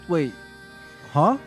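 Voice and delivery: ordinary delivery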